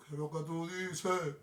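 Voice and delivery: slow, stretched-out show title; drawn-out catchphrase. Acted. Host closing the show with its title, drawn out. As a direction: draw out "tú dices" like a slogan.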